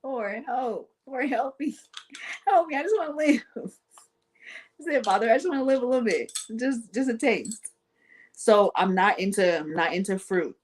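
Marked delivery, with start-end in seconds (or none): 0.0-7.7 mock pleading. Dramatic plea to Father.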